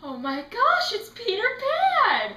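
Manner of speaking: loud fangirl cry, rising high; starstruck excitement. Retold or imitated. Fan spotting Peter Pan in line, starstruck excitement. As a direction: gush it, stretching the name high. Retold fan reaction, sister screaming.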